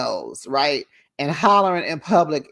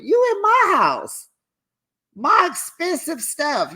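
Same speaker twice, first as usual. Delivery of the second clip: outraged squeal; 12 semitones higher than usual. Retold or imitated. Vlogger voicing the host, squealing in outrage. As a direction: shriek it like the house is yours.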